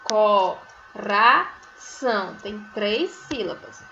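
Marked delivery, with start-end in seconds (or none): 0.0-2.3 coração counted out as có, rá, são; teacher slowly clapping out coração by voice; syllable by syllable. Shown as a demonstration. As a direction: separate CÓ-RÁ-SÃO with long gaps. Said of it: "tem quantas sílabas".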